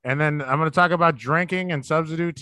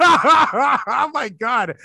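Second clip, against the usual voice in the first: laughing; 7 semitones higher, 5 dB louder, much slower than usual. Real, not acted. Laughing at the offered impression.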